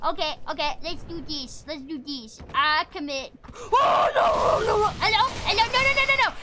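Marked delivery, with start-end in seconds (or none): 3.5-6.3 high, loud panicked "no" run; frantic, raised-voice protest. Real, not acted. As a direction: blurt it out in rising panic. Panics at dying in game.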